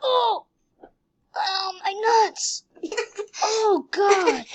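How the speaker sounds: high yelps breaking into laughter; giggling fake ouch; laughing mock pain. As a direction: cry out and crack up. Painful crash, laughing.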